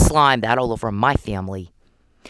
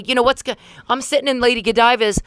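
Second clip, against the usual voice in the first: usual speaking voice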